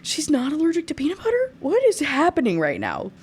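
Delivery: a reader stunned by the twist; shocked reaction. Retold or imitated. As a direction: react like a plot twist just hit. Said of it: "I said".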